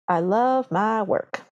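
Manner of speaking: slow, drawn-out godmother quote; lightly voiced godmother, stretching each word; fairy godmother. Quoting fairy godmother's parting line.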